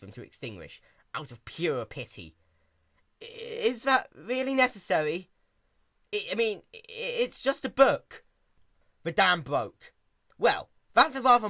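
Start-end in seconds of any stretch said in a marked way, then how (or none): 3.2-8.2 higher, stuttering nervous plea; stammering fear. Acted. Caramel pleading with furious Twilight, stammering. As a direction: stammer it out scared.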